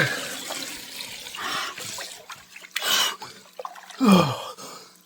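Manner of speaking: long bracing sigh before the cold; big loud exhale, steeling himself; heavy sigh. Real, not acted. About to enter cold plunge.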